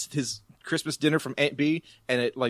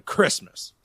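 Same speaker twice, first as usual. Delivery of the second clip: word capped with a loud wordless burst; Ben's catchphrase; 13 dB louder than usual. Acted. Ben's final button at the episode's end, barked noise. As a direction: say it, then blurt a short bark. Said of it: "has to be Ben going".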